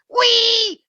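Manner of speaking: put-on a kid squealing wee to disrupt the chant; squealed wee. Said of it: "so it's like".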